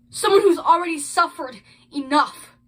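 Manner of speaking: acted grief breaking out at volume; anguished outburst. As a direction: cry out against the injustice. Acted grieving parent's statement.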